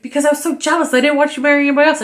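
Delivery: blurted confession, high and rushed at the end; mock heated confession. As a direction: imitate a husband bursting out with his excuse. Retold heated confession in a quarrel.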